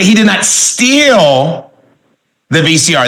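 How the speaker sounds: steal stretched out long, then a pause; stress on steal. Shown as a demonstration. Trainer shows class voice inflection, stress on steal. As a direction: draw out steal with a rising pitch. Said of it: "just by using voice inflection".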